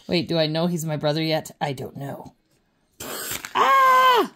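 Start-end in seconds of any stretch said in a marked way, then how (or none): 3.5-4.3 high loud cry of alarm; play scream. Pretend. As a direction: shriek as the ship goes down. Figure's ship gets wrecked.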